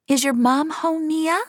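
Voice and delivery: put-on high, sing-song voice for a kid; awkward adult voice. A narrator's reading. Finch asking the little girl, high and sing-song. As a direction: talk up to the child like a depressed robot trying to be nice.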